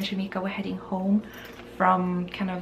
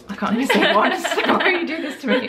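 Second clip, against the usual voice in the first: high, loud, laughing complaint; laughing protest; 6 semitones higher, 5 dB louder than usual. Real, not acted. Friend challenges her to a tongue twister, laughing protest. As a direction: protest helplessly through laughter.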